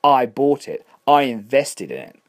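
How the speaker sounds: builds from calm to a loud, offended outburst; indignant landlord. Acted. Landlord rebuking Catherine, growing loud and indignant.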